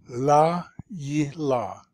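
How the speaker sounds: slow "La Yi La", evenly broken; syllable by syllable. Shown as a demonstration. Teacher repeating a favourite word, "La Yi La" slowly split. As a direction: draw out each piece of "La Yi La". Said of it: "So open, open, closed".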